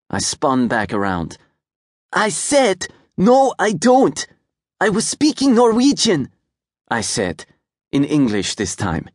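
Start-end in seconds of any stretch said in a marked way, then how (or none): 2.1-6.3 put-on high, louder indignant little boy; angry boy. A narrator's reading. Angry little boy correcting the girl, louder. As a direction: insist hotly like an offended kid. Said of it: "Feeling angry".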